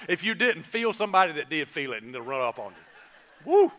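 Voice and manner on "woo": usual speaking voice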